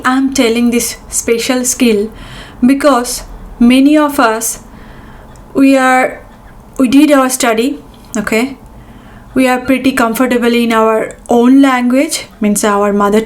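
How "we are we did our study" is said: usual speaking voice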